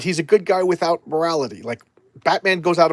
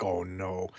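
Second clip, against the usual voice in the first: low, soft mock dismay; understated retold dread; hushed dread; 7 semitones lower, 10 dB quieter than usual. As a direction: sink into a quiet 'uh-oh'. Reacts to the Spectre's arrival.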